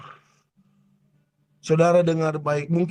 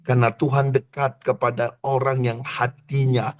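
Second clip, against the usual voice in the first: hushed altar-call preaching; 8 semitones lower than usual. Acted. Soft, intimate altar-call preaching.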